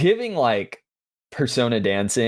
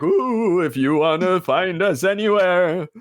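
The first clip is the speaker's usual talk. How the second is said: higher, hooting spooky ghost read; 6 semitones higher than usual. Acted. Host plugging the podcast in a lightly spooky voice. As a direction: play it spooky with an ooh. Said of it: "how else do I do it spooky? I could just be like".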